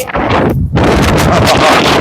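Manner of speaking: short airy laugh; breathy laugh. Real, not acted. Coach laughs at the muddled drill.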